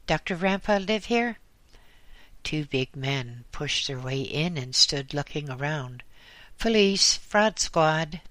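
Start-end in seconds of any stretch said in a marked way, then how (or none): 0.1-1.4 put-on louder, higher French-Canadian accent; gruff rising question in French-Canadian accent. A narrator's reading. Policeman asks for Rampa, gruff French-Canadian. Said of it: "asked a gruff French-Canadian voice". 6.6-8.2 loud, higher official announcement; announcing police. A narrator's reading. Policeman announces himself, loud. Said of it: "said one at length".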